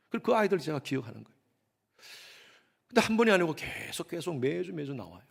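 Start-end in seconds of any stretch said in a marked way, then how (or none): none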